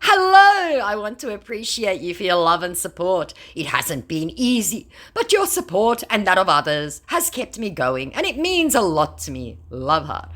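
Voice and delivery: lightly voiced gushing fake star; fake celebrity message. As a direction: read it with a hint of fake sweetness. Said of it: "Meet David Duchovny".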